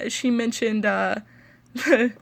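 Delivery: ordinary talk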